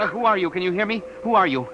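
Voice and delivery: eager radio operator calling loudly; urgent calling. Acted. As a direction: demand an answer through the static. Urgent questions over the radio.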